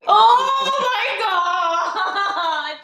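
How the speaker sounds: loud laughing gasp of shock. Real, not acted. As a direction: burst out laughing in disbelief. Reacting to shocking question.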